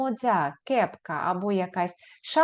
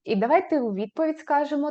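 ordinary talk, nothing marked

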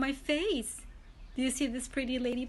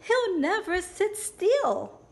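high, louder and slow; high ladybug voice; 7 semitones higher, 4 dB louder, slower than usual. Acted. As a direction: complain in a tiny high voice. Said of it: "said the lazy ladybug".

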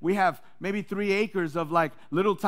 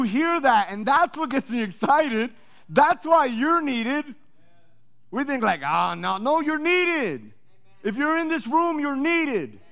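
high, loud, slow preacher's insistence; loud, slow sermon point; preaching at full force; 7 semitones higher, 6 dB louder, slower than usual. Real, not acted. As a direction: plead with every listener.

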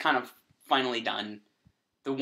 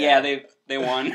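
laughing; 9 dB louder than usual. Retold or imitated. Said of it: "I was like".

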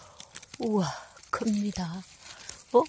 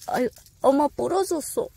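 high loud cry of dismay; dismayed shriek; 9 semitones higher, 6 dB louder than usual. Real, not acted. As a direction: shriek as it breaks. Mushroom snapped while picking.

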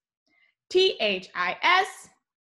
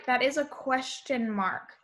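normal talking voice

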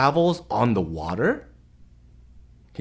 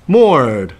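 put-on reading to children. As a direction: read it like bedtime for little ones. Reads picture book to kids.

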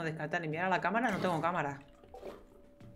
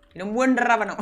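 louder, pleading for a good radish; loud demand; 5 semitones higher, 11 dB louder than usual. Real, not acted. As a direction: call it out, half-joking, wanting radishes. (exigiendo en voz alta, medio en broma)

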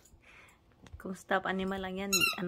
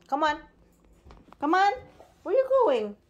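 loud high call; calling the dog; 7 semitones higher, 11 dB louder than usual. Real, not acted. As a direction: call her in, rising and loud.

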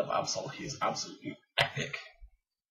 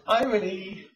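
high-pitched mock call; retold shout; 8 semitones higher, 4 dB louder, slower than usual. Relaying a friend's shout to viewers, mock shouted call. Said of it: "my friend shouted too".

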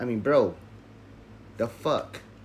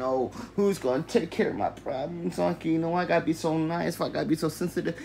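higher, whiny mock sob-story voice; mocking his crying self; 6 semitones higher than usual. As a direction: mimic a weepy self-pitying version of yourself. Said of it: "who cried in twenty twenty".

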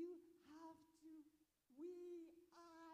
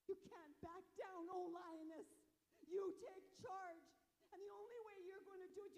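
rallying cry; 4 semitones higher, 7 dB louder than usual. Real, not acted. Rallying the women.

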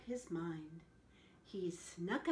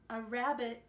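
plain reading voice

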